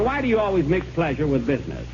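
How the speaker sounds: ordinary talk, nothing marked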